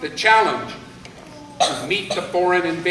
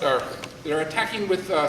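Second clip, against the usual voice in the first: ordinary delivery, nothing marked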